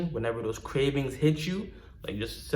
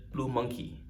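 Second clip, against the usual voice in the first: soft repetition; 9 dB quieter than usual. Shown as a demonstration. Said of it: "I should have just said".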